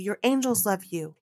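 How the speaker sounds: usual speaking voice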